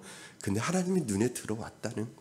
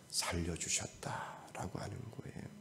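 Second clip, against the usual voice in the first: breathy hushed whisper to the congregation; 3 semitones lower, 14 dB quieter than usual. Real, not acted. Preacher whispering the point to the church. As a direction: drop to a secret whisper.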